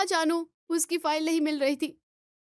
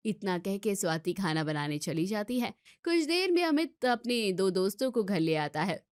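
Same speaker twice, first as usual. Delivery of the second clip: story narration; 6 semitones lower than usual. A narrator's reading. Narrator telling the audience what happens next, low and calm.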